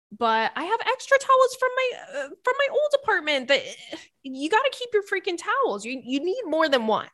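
high, strained towel rant; worked-up high-pitched insistence; mock exasperation. Real, not acted. Mock rant over keeping towels.

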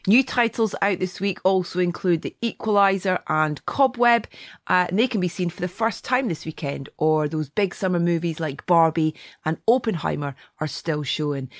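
ordinary talk

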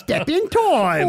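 slow, high, sing-song mock Cockney. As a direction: stretch it out like a Mary Poppins chimney sweep.

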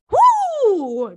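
high, loud whoop; whooping, carried away; excited whoop. Real, not acted. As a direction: let out a giddy high "woo". Carried away by full benefits.

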